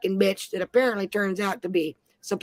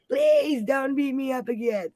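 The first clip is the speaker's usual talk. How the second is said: mock bawling plea; 4 semitones higher, slower than usual. Woman on her knees begging the teller, mock bawling. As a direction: play her pleading on her knees, overdone. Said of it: "starts bawling".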